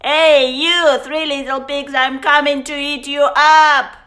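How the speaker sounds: loud, fast growled wolf shout; wolf roaring down the chimney; growling wolf. Acted. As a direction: bellow it down the chimney. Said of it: "the wolf growled".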